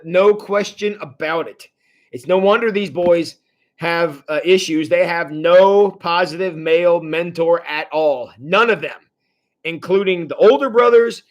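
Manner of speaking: usual speaking voice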